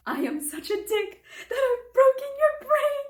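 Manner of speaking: high, loud wail breaking with laughter; cracking up. Retold or imitated. Reader quoting the funniest line she's read, cracking up. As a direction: squeal the quote out, helpless with amusement. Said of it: "I was cracking up".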